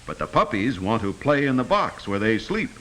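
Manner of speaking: ordinary talk, nothing marked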